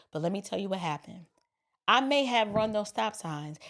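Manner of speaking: mock-humble plea to an officer; contrite explaining. Hypothetical driver owning up to the officer, contrite and subdued.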